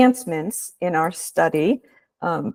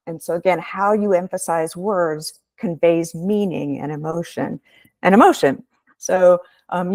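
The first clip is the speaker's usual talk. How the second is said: ordinary talk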